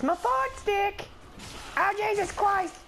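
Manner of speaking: high, drawn-out startled yelp; startled outcry. Pretend. Player attacked in the game, startled high outcry.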